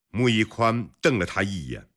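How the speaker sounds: usual speaking voice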